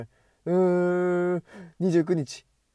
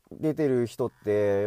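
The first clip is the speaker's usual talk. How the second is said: ordinary talk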